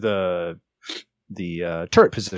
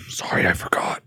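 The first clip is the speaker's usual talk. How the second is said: soft unvoiced whisper; 3 dB quieter than usual. Real, not acted. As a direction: whisper it as an aside. Whispered apology mid-speech.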